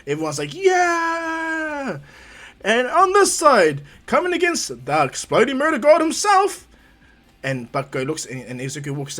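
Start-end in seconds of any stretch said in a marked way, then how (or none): 0.5-2.0 long, high, loud cheer; stretched-out high whoop; crowd cheer. Acted. 2.6-6.7 loud, high hype-man introduction; big ring-announcer build-up; tournament announcer. Acted. Announcer introduces the other side.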